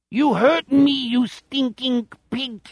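high, slow alien-German whine; outer space German accent. A narrator's reading. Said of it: "outer space German accent".

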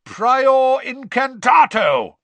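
loud, high roar, drawn out; a high, booming spell-caster's roar; roared incantation. A narrator's reading. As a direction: roar the spell slowly and forcefully. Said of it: "roared Mister Diggory".

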